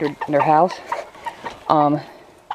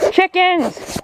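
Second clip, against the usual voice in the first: excited call; 10 semitones higher than usual. Real, not acted. Greeting the chickens excitedly.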